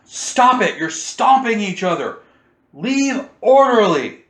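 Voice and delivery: high, loud order to a crowd; commanding yell. Acted. Game master voices a character ordering a crowd, commanding yell. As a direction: yell it commandingly, drawing out the last words. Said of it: "she yells at the crowd in a commanding voice".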